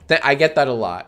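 ordinary talk, nothing marked

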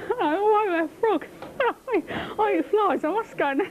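high frog voice with fingers stretching the mouth; wide-mouthed frog. Acted. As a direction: mouth pulled wide, breaking up as you play the frog. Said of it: "put your fingers in your mouth".

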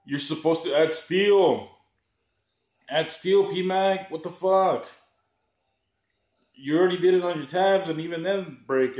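hammed-up complaining gun owner; mocking whiny buyer. Mocking the buyers who whine about broken mags, in a hammed-up whine. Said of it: "and they're like".

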